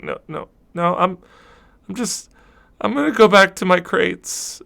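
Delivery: put-on a young crewman backing off, embarrassed; shy high voice. Kestrel shyly declines the offer.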